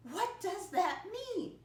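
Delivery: very high-pitched, exaggerated wondering; puzzled pupil's question. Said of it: "you ought to be asking yourself a question".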